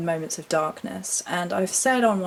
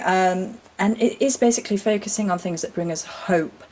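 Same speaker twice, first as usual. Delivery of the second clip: ordinary delivery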